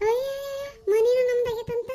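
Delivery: very high mocking squeal; ridiculing the neighbour's claim on the house; sarcastic mockery. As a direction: mimic her boast in a shrill mocking voice. Mocking the rival's boast.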